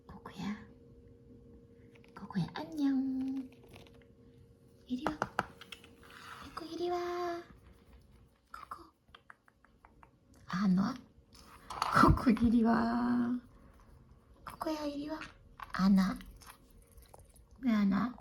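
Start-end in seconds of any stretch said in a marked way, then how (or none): 0.1-8.8 very soft, gentle call to a pet; hushed pet-calling; soft coaxing. Real, not acted. 12.0-13.4 slow, stretched-out pet call; lingering come-here call; drawn-out call. Real, not acted. Calling the pet over. 14.5-15.2 higher, very soft pet call; small, hushed come-here; soft coaxing. Real, not acted. Softly calling the pet.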